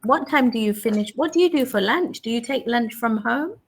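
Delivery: ordinary talk, nothing marked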